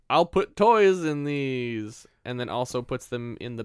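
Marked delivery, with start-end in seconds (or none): 0.1-2.1 louder, very slow, drawn-out discovery; Santa's idea. Retold or imitated. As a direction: mull it over like a bright idea. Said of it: "is like".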